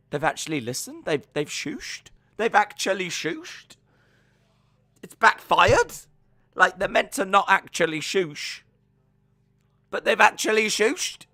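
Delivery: high, incredulous reporting-back voice; rising astonishment, as if it has all backfired; acted disbelief. Reporting back to Vince that they shooshed, in acted disbelief. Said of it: "back to Vince and he's like".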